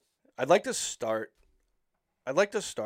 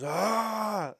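higher, imitated attempt at a big scary roar; mock growl; 10 semitones higher than usual. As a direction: play the brave first roar, half-hearted. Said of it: "he growls at it".

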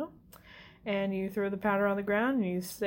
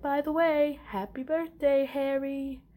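retold Ron; 7 semitones higher, 3 dB louder than usual. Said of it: "Ron's all like".

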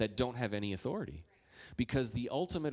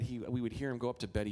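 normal talking voice

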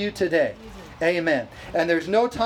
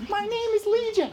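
very high, squeaky little demon; 13 semitones higher than usual. Acted. Preacher joking as the unclean spirit, high-pitched. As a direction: pitch it way up for a comic airy demon. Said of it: "They're either high or low".

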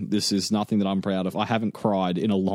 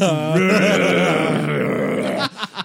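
loud guttural zombie growl; zombie snarl; 5 semitones higher, 4 dB louder than usual. Acted. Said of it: "patient zero in a zombie movie".

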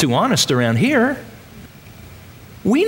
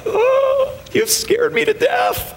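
mock fright; slower than usual. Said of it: "knowing that we're like".

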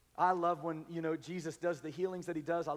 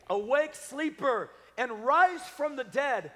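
slow, high, loud proclamation; rousing call; 6 semitones higher, 7 dB louder, slower than usual. Acted. Preacher to congregation quoting scripture, rousing call. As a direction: call out the verse slowly to rouse them. Preacher calling sleepers to wake.

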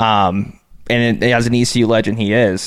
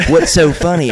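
light Southern country twang, pitched higher; country accent; 6 semitones higher than usual. Retold or imitated. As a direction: coach demanding to know why his players are laughing, in a light country accent. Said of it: "in his country accent".

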